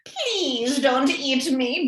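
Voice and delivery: slow, pleading granny, only lightly voiced; begging. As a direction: beg slowly for mercy. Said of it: "begged grandmother".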